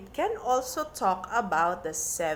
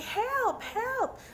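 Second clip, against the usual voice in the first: much higher, urgent mock call for help; mock cry for help. Shown as a demonstration. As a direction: cry help like a pretend emergency. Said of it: "there's an emergency and you say".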